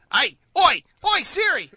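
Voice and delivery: high, loud mock Aussie call; a hammed-up Australian hailing; Australian accent. Calling his Australian Siri.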